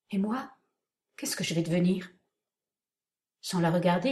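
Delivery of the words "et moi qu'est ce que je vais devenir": trembling voice. A narrator's reading. Chiffon asks her departing uncle, trembling. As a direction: ask it fearing to be left behind. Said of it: "d'une voix tremblée".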